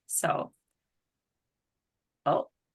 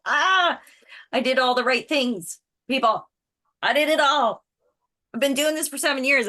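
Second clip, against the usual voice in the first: louder, higher exasperated vent; real frustration; 5 semitones higher, 5 dB louder than usual. Real, not acted. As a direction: let off steam about doing everything right. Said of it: "venting about people quitting".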